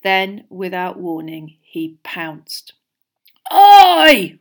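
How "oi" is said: sudden high, very loud roar. A narrator's reading. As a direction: bellow it in outrage. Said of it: "Ron roared".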